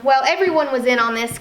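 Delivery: normal talking voice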